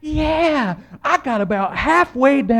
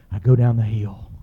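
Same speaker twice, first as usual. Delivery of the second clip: ordinary talk, nothing marked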